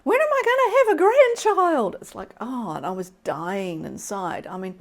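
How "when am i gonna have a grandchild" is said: high, loud mock mum demanding a grandchild; imitating mum. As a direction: squeal it out like a pushy mother in public. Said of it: "my mum very publicly going".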